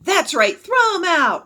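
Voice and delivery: high, loud, hostile yell; jeering high-pitched holler; woman shrieking. A narrator's reading. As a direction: shriek it from the stands. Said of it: "a woman shrieked".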